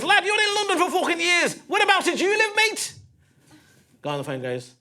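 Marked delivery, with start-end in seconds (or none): 0.0-2.9 high, loud Scouse cabbie; overexcited Scouse greeting, pitched way up; Scouse taxi driver. Acted. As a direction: play a hammed-up Scouse driver. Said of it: "Taxi driver goes".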